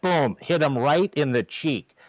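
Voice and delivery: usual speaking voice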